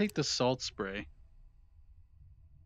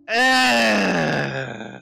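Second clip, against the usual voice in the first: startled cry; 11 dB louder than usual. Real, not acted. Startled cry in horror game.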